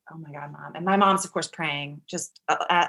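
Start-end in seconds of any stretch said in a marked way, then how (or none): 0.1-0.7 very soft, low aside; hushed dismay. Retold or imitated. Waiting with her mom and the baby, hushed. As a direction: murmur it almost under the breath.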